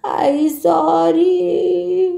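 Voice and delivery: very slow, drawn-out tearful apology; a small boy dragging out a sorry through mild tears. Acted. Boy Ashish apologizes to his mother, slow and mildly tearful. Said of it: "आधी रडत थांबव बरं".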